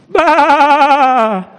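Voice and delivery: very loud, high bleat with everything she has; loudest bleat. A narrator's reading. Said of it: "as loud as she possibly could".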